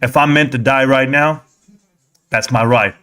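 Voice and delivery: lightly voiced old father, steady and sure; a hint of a wise dad at peace with fate; his calm father. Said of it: "He says, uh".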